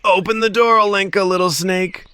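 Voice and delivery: high snake mother's call. Acted. Snake calling daughter to open the door, high. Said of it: "she called".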